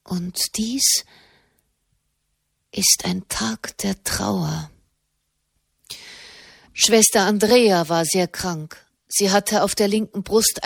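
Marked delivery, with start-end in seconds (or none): 0.0-4.7 slow, subdued grief; deep sorrow. A narrator's reading. Katharina declares a day of mourning, in deep sorrow. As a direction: draw it out mournfully, pausing midway. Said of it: "sagte sie in tiefem Kummer".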